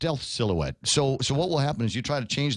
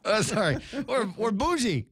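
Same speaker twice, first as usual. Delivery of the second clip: hammed-up snooty brag, higher; mock bougie; 7 semitones higher than usual. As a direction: boast about being posh in a lightly mocking voice. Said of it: "funny in my head".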